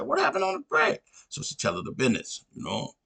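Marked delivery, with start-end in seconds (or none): 0.0-1.0 high, squeaky falsetto asking for the dirt; eager gossip. Retold or imitated. Girlfriend pressing for the break story, high eager squeal. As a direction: pitch way up like a friend hungry for details.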